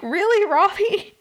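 high, loud, drawn-out disbelieving question; incredulous. Retold or imitated. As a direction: question him like you can't believe it. Her acted reply to Robbie's compliment.

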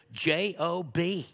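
J O B, each letter slow and separate; spelled out slowly. Retold or imitated. Parent answering a child asking for money, spelled slowly.

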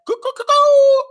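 very high, loud mimicked cock-a-doodle-doo; rooster crow. Comic imitating the rooster in the background, crowing. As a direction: crow like a rooster, last note held. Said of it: "Did you hear the rooster".

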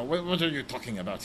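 cartoonish French accent; ridiculous French accent. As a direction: act baffled in a hammed-up French accent. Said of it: "most cartoonish French, French accent".

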